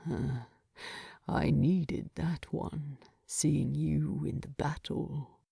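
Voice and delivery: whispering, barely audible; wounded dwarf murmuring from his sickbed; low hoarse-soft whisper of the wounded king. Acted. Wounded Thorin whispering to Bilbo, unvoiced and low.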